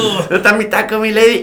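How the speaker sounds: high teasing call with a laugh; calling out to Lady. Retold or imitated. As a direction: laughs while calling for her. Jokingly calls out to [name].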